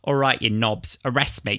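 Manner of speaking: half-hearted Cockney defiance; fake Cockney accent. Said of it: "Cockney accent".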